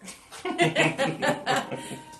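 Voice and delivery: bursting out laughing; big real laugh; loud laugh. Real, not acted. Host laughs at guest's joke.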